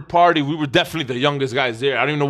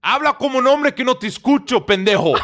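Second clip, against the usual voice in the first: gruff doorman in Spanish; 8 semitones higher than usual. Retold or imitated. Spanish doorman mocking the boy to talk like a man. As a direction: play the scornful doorman, half laughing. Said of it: "And then he said".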